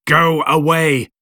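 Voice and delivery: high, drawn-out mild shout. A narrator's reading. Creator orders the creature away, high mild shout. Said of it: "I shouted".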